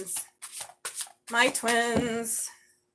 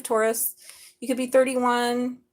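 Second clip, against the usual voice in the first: usual speaking voice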